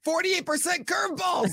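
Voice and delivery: real high-pitched fan yell; excited shout. Real, not acted. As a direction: cry it out in sheer excitement, pitch way up. Host erupts over pitcher's curveballs.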